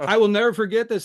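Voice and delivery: emphatic. Real, not acted. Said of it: "emphatic storytelling peak".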